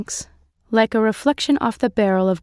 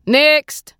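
loud, higher barked call; shout; 8 semitones higher, 7 dB louder than usual. A narrator's reading. Soldier calling the next recruit, loud shout. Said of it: "and shouts".

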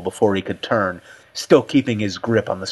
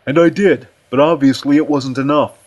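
higher-pitched defensive character voice; countering retort; 5 semitones higher, 5 dB louder than usual. A narrator's reading. Aussie defends himself to Richardson, countering. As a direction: push back in a raised voice. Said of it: "Aussie countered".

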